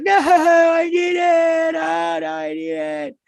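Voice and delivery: high squealing mock plea, slowed down; whiny girl voice. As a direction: whine it like a spoiled girl. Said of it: "she's like".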